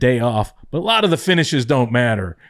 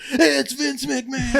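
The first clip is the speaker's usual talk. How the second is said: much higher, laughing excitement; excited; 12 semitones higher, 3 dB quieter than usual. Retold or imitated. Family spotting Vince in the limo, high laughing excitement. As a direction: squeal it out like thrilled fans.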